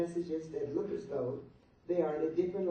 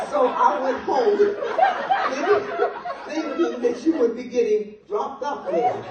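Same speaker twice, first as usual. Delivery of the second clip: words pushed out through laughter, higher and louder; giggly, raised and loud; laughing while talking; 8 semitones higher, 9 dB louder than usual. Real, not acted. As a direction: keep cracking up as you tell it. Laughing through the pager story.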